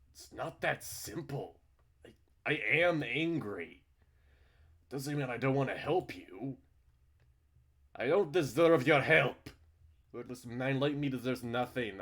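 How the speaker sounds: loud, high, strained protest; an angry, torn plea; angry pleading. Acted. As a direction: argue back through the hurt. Heated quarrel between the two characters.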